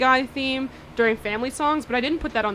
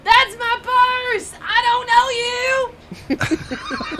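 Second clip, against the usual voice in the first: high, slow, far yelling voice; Bobby Hill impression; 13 semitones higher, 5 dB louder, much slower than usual. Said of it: "my favorite, uh, impression".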